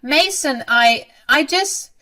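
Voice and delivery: high, loud, halting stammer; flustered. A narrator's reading. Mother caught by her angry son, flustered. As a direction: grope for an excuse, caught out.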